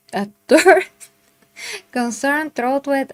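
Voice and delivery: "third concern, throat with" re-read through a laugh, starting high and loud; laughing retake. Shown as a demonstration. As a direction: laugh at your own misreading and try the words again.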